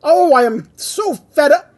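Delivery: loud, high-pitched exasperated outburst; Grinch flipping out. A narrator's reading. Grinch flips out at the camera, fed up. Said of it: "starts to flip out".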